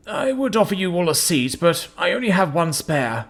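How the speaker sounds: a weary host, apologetic; old amphibian man. Acted. Old man voiced in story.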